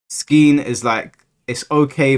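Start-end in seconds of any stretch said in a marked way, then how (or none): 0.1-0.6 dismissive skeen. Retold or imitated. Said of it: "it's an aggressive and dismissive "okay". 1.7-2.2 okay snapped out, louder; a curt, aggressive okay. Shown as a demonstration. Demo to viewers of an aggressive okay. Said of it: "but it's an aggressive".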